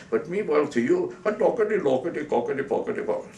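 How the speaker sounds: mock Indian accent gibberish. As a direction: rattle nonsense in a put-on Indian accent. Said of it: "found out the Indian was an American citizen".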